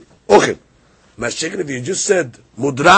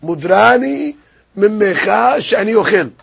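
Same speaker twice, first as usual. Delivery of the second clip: called out higher and louder; raised voice, stressing mimecha; emphatic quote; 6 semitones higher, 7 dB louder than usual. Shown as a demonstration. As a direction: proclaim the phrase with force. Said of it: "Just say".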